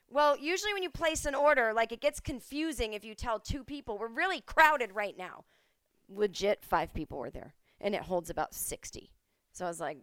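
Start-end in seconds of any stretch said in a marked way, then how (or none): 0.1-5.4 higher, louder lecturing; condescending bartender. Retold or imitated. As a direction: explain it like to a child. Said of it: "And she goes".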